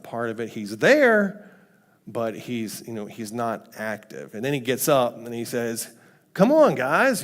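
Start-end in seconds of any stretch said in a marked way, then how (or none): 6.3-7.2 high, louder acted protest; mild exasperation. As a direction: chide the guys for fussing. Jesus waking, exasperated with disciples.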